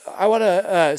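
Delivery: loud, eager rush; can't contain the excitement; excited. Real, not acted. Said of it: "I'm getting way too excited".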